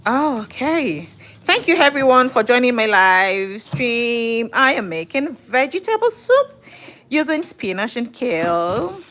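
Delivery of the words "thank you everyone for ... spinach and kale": slow, higher presenter delivery; livestream intro. Acted. Host announces vegetable soup to livestream viewers, presenter voice. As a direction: welcome the audience with drawn-out line endings.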